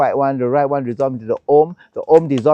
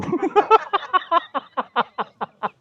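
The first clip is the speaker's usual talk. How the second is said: high amused laugh; real laugh. Real, not acted. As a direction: laugh at the impression.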